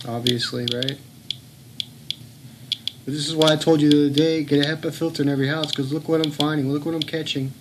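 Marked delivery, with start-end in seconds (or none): none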